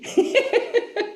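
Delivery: happy giggle; high bright laugh. Real, not acted. Delighted about Kat's feelings.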